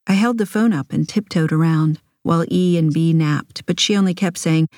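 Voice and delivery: ordinary delivery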